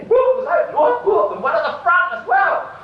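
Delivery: yelled whoop in ecstatic vision. Acted. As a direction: burst out in wonder, whooping.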